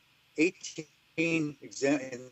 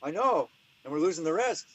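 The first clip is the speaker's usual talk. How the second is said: light imitated Irish accent, pitched up; mock Irish agreement; 5 semitones higher than usual. The Irish lab man agreeing on the phone, in a light Irish accent. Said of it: "I'm gonna stop doing my Irish".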